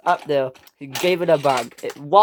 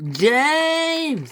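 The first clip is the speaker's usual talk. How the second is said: very high, drawn-out yell; long, high angry call; screamed name; 12 semitones higher than usual. Acted. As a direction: scream his name to summon him for a telling-off. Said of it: "screamed Top Hat".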